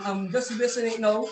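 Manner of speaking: usual speaking voice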